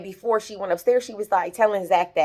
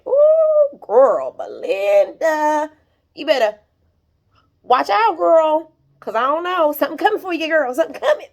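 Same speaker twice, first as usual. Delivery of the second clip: ominous taunt; 8 semitones higher, 4 dB louder than usual. Pretend. Taunting warning to the character Belinda.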